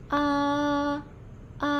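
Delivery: "A" held level and flat; "A" sung flat like a held note; first tone. Shown as a demonstration. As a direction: keep "A" on one steady pitch, no rise or fall. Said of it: "untuk nada satu itu datar".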